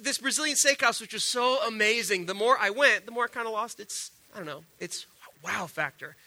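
usual speaking voice

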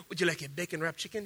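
a hint of a hushed server pitching meat; Brazilian waiter. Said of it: "somehow Brazilian guys are talking like".

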